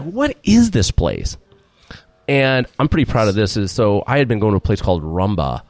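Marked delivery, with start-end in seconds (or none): 0.0-1.4 high, slow, puzzled; baffled question. Retold or imitated. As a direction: stretch it out like a confused customer. Everyone reacting to the restaurant.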